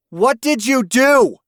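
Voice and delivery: high, loud furious demand; demanding shout. Acted. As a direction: shout at the husband. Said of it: "Doreen demanded".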